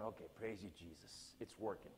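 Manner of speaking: low, hushed inner praise; thanking Jesus in his head as the plan works; quiet relieved aside. Retold or imitated. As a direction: murmur thanks under your breath. Private relieved prayer aside.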